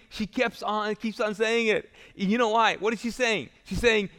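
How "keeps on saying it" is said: normal talking voice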